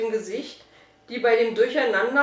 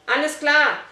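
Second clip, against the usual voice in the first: louder, higher quick reply; shouting back; 5 semitones higher, 6 dB louder than usual. A narrator's reading. Boy answers his hurrying friend, shouting back. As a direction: call back your agreement. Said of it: "schrieb Fabian zurück".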